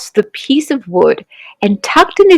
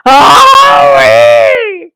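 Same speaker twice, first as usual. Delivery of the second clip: hurt yelp; 3 semitones higher, 5 dB louder than usual. A narrator's reading. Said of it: "cried the crocodile".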